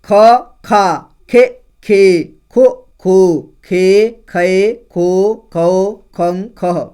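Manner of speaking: each vowel called out loud and clear, with pauses; loud syllable drill. Shown as a demonstration. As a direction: recite the vowels slowly for the class. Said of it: "अब ख का देखिए". (ज़ोर से धीरे-धीरे स्वर बोलना)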